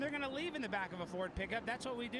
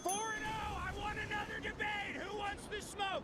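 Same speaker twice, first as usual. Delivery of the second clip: triumphant shout; 13 semitones higher than usual. Real, not acted. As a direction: crow it out like a champion. Celebrating debate win.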